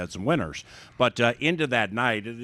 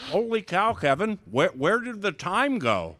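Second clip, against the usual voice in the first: surprised; 9 semitones higher than usual. Real, not acted. Amazed the season flew by.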